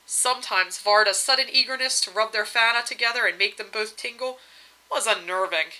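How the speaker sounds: ordinary delivery, nothing marked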